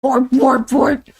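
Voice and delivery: loud, bouncy robot beeps; robot noises. Acted. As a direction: beep like an eager robot. Clank the robot excited.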